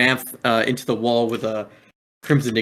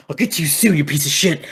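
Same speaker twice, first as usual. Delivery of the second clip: higher, louder snarled threat; 5 semitones higher, 4 dB louder than usual. Acted. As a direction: spit it at the downed enemy. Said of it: "And he goes".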